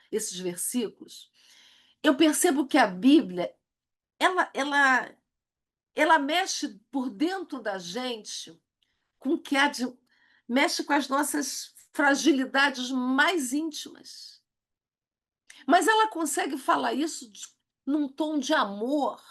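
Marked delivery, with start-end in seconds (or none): none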